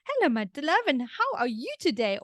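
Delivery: put-on high, lilting greeting to a baby; affectionate baby talk. Parent answering baby's first hello, affectionate high baby talk. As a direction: coo a delighted hello to your little one. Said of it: "instead of having somebody go".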